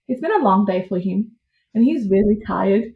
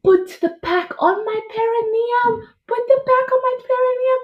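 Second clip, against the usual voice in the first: much higher, strained demand; 12 semitones higher than usual. Retold or imitated. Mother at ring of fire demanding heat pack, strained. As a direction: push it out at the peak.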